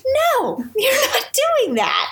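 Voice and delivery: laughing refusal. Retold or imitated. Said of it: "She's like".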